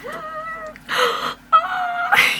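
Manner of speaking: high squealing gasp of surprise; a sharp excited intake of breath, very high; girly gasp. Retold or imitated. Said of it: "I did that really girly thing".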